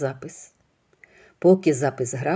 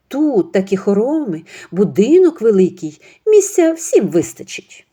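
put-on smug, higher and louder older woman; scheming mother-in-law; 5 semitones higher, 6 dB louder than usual. Mother-in-law gloating to her friend about the big house. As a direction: gloat over the plan.

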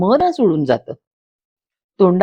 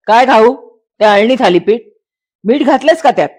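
louder, annoyed husband snapping over bland food; peeved, raised voice; irritated grumble; 4 semitones higher, 6 dB louder than usual. Acted. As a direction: complain sharply about the salt. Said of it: "तो चडफडतो".

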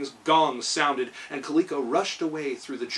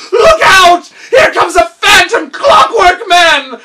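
loud, high panicked yell; terrified scream; 18 dB louder, slower than usual. A narrator's reading. Gnome king screaming a warning to his workers. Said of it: "screamed the terrified monarch".

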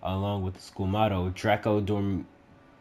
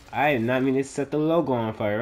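usual speaking voice

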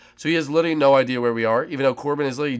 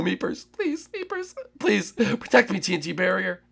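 ordinary talk, nothing marked

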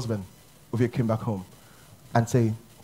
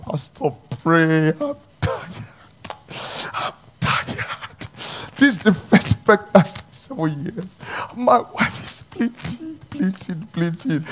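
retold crying plea; 5 semitones higher, slower than usual. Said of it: "He said".

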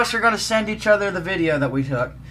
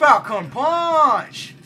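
slow drawn-out Falcon Punch battle cry; Falcon Punch yell; 4 semitones higher, 3 dB louder, much slower than usual. Pretend. Launching his rider with a drawn-out Falcon Punch battle cry. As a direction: stretch every syllable like a fighting-game hero. Repeats the catchphrase.

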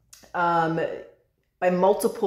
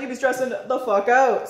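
playful slangy tease; 4 semitones higher than usual. Real, not acted. Repeats line in slangy teasing voice.

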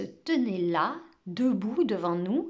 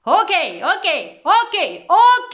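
high, loud calls climbing higher; siren-like shouting; 8 semitones higher, 11 dB louder, much slower than usual. A narrator's reading. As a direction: shout the class down, rising each time. Professeur hurle pour faire taire. (cris montants de sirène)

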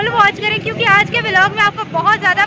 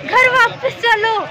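higher, drawn-out mimicked call from behind; imitated calling out; 4 semitones higher, slower than usual. Companions telling the vlogger to turn back, imitated calling out. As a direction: call her home, stretching the words. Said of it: "मुझे पीछे से आवाज़ें आ रही हैं".

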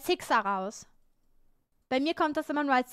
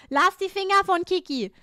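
loud, higher indignant command; mock scolding; 5 semitones higher, 8 dB louder than usual. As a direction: warn them off sternly and loudly. Protests jokingly at chat about charging. (lautes, empörtes Schimpfen)

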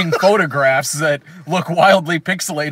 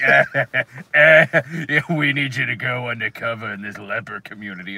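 lower, softer mock mobster voice with a cigar in the mouth; mafia boss; 6 semitones lower, 3 dB quieter than usual. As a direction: mumble like a movie mafioso chewing a cigar. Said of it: "dubbed by somebody else with just this mafia cigar".

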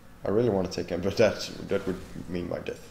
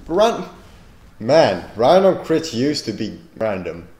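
annoyed complaint; 3 semitones higher, 11 dB louder than usual. Real, not acted. Complaining about random crits.

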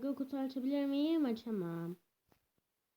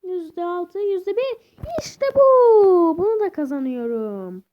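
louder and higher to a cheer; rising excitement; 6 semitones higher, 20 dB louder than usual. Real, not acted. Gamer watching the odds, then cheering loudly. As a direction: build to a victory yell.

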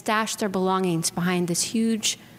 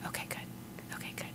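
very soft unvoiced whisper of relief; relieved whisper; 19 dB quieter than usual. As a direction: whisper it as the danger passes. Mountain lion walks away.

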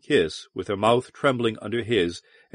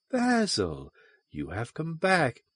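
higher, softer, singsong lilt; 4 semitones higher, 7 dB quieter than usual. A narrator's reading. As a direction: let the voice ripple and sing. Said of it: "Her voice sang against him".

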